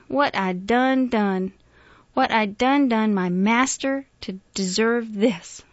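slow, loud, grieving appeal; drawn-out, despairing question; anguished lament. A narrator's reading. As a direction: wail it slowly without sobbing. Miss Emma laments, about to cry.

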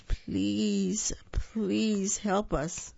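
slow, drawn-out beg; a small toy's drawn-out plea; begging plea. A narrator's reading. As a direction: stretch each please like a child begging. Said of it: "cried the dolls and toys".

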